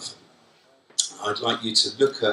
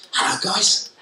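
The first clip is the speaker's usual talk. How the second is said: a bright, smiling hello, higher than usual; smiling friendly greeting; 8 semitones higher than usual. Shown as a demonstration. To Jordan and Courtney, showing liking with a smiling hello. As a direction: greet them warmly with a smile. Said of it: "I'm communicating how much I like you ... by smiling at you".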